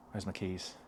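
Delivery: dismayed mutter. Retold or imitated. Realising keys locked in.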